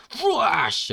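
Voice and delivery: loud, high whooshing slash; comic-book attack noise; slash sound effect. Acted. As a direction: voice a sword swipe explosively. Imitated sword attack noise.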